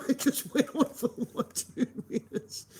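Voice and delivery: high, breaking into laughter; laughing in disbelief. Real, not acted. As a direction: giggle through the words. Laughing at the absurd standoff.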